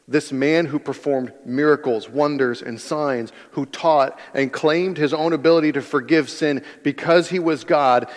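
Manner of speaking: usual speaking voice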